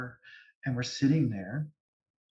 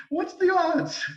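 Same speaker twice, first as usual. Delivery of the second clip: amused disbelief; 4 dB louder, much slower than usual. Real, not acted. Still amused after laugh.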